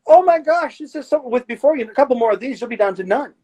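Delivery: high, delighted cheer; excited. Retold or imitated. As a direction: celebrate it, pitch way up. Therapist celebrating patient's progress.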